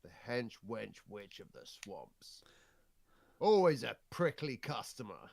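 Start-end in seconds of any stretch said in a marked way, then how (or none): 0.0-2.4 whispered hench wench witch of the swamps. Pretend. Repeated as a whisper.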